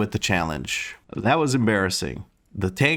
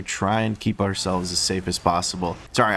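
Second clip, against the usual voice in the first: ordinary talk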